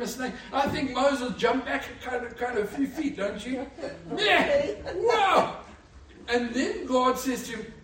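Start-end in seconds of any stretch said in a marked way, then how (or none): 4.2-5.6 high, loud startled whoa; startled yelp. Acted. Preacher acting Moses leaping from the snake, startled yelp. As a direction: jump back from a snake with a yelp.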